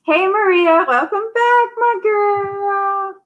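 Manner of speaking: very high, gushing welcome; excited greeting. Real, not acted. Host welcoming Maria back to the stream, excited. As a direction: call out sky-high, stretching the last words.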